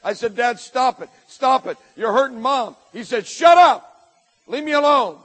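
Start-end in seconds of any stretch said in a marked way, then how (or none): 0.4-2.8 louder, higher plea to stop; retold desperate yell. Boy begging his dad to stop hurting Mom, yelling. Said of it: "I yelled, I screamed". 3.3-5.2 full-force, high shout of a raging father; angry yell. Retold or imitated. Drunk father to his pleading son, shouting angrily. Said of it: "the way he was yelling and cursing".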